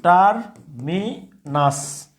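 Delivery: টার্মিনাস drawn out very slowly; slow model pronunciation. Shown as a demonstration. Teacher listing words, very slow. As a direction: stretch টার্মিনাস syllable by syllable. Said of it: "okay".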